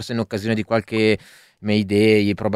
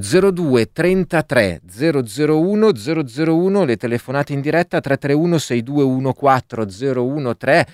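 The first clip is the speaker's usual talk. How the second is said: radio announcer; 4 semitones higher than usual. Acted. Radio host reads call-in numbers.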